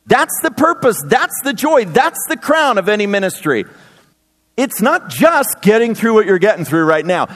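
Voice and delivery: ordinary talk, nothing marked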